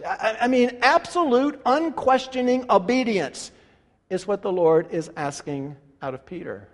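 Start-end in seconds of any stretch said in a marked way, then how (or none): none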